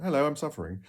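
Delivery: mock honest greeting. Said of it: "walked up to you and went".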